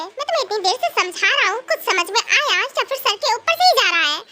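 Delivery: usual speaking voice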